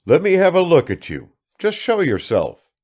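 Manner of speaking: high, loud, quick request; eager father. A narrator's reading. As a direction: ask eagerly to see your son. Eager father inspecting his son.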